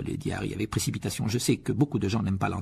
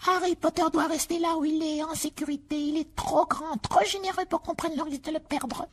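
house elf Dobby. Acted. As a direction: squeak it out like an earnest little elf.

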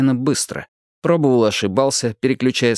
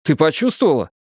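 lively, higher-pitched question; оживлённый вопрос; 5 semitones higher than usual. A narrator's reading. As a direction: ask excitedly, pitched up. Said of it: "закричал он оживлённо". (оживлённо, повыше)

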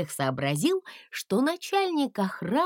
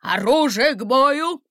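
shouted command; 5 semitones higher, 6 dB louder than usual. Acted. Guard orders weapons ready.

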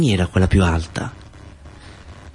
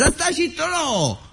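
very high squeaky protest, softer; jealous Nunzio; 5 dB quieter, much slower than usual. Acted. Nunzio cutting into Mustazza's chant, high jealous squeal. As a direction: squeal the no in envy. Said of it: "diceva Nunzio, un poco gelosa".

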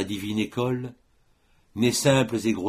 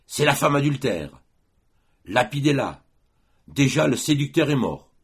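higher, louder accusing cry; raised mob shout, condemning; crowd accusing; 6 semitones higher, 4 dB louder than usual. Acted. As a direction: hurl it like a mob out for blood. Crowd demands stoning.

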